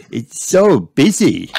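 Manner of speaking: put-on high, slow child's wonder; amazed. A narrator's reading. Peppa looks round London for the first time, amazed. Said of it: "gasped Peppa".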